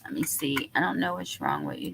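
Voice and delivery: ordinary talk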